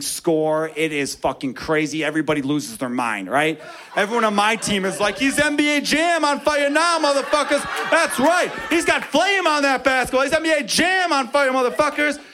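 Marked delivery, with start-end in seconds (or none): 5.2-12.2 hyped announcer shout. Retold or imitated. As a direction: call it like a video game announcer. Team hypes the third basket.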